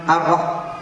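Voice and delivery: ordinary talk, nothing marked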